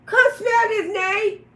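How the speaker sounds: high loud call to Nate; retold shout. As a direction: yell it at Nate, insisting. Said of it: "I say".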